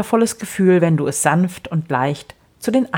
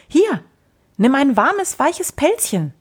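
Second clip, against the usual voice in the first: high, bright little creature voice; small creature voice; 9 semitones higher than usual. Acted. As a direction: lift it high like a tiny friendly being. Little Swabiduda offers a Pelzchen.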